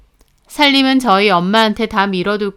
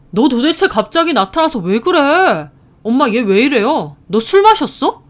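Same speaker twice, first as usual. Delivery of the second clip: irritated, higher-pitched retort; annoyed disbelief; 4 semitones higher, 4 dB louder than usual. A narrator's reading. Brother challenging his yelling sister, annoyed disbelief. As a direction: question her sanity, irritated.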